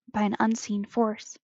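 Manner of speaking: plain reading voice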